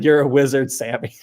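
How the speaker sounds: normal talking voice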